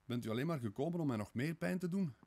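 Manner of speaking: hoog en luider, wanhopig smekend; wanhopig smeken. A narrator's reading. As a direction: smeek hem ten einde raad. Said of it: "smeekte Sonja in wanhoop". (Sonja smeekt Raskolnikov hoog en wanhopig)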